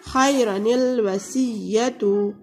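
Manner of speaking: "खैरनिल वसीयतु" drawn out, nun linked to lam; slow Quranic recitation. Shown as a demonstration. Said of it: "नून कतनी लगाते हैं".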